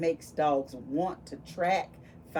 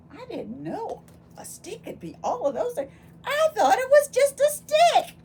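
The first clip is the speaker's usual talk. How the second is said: much higher, louder amazed voice; mock amazement; 7 dB louder than usual. Teacher feigning amazement for the children, high and loud. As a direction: act wide-eyed astonishment.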